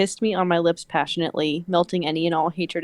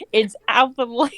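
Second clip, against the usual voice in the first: higher voice, cracking up; growl broken by laughter; 6 semitones higher, 4 dB louder than usual. A narrator's reading. Mate correcting her, reader laughing through it. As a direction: try the villain's line while giggling. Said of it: "he growled at me".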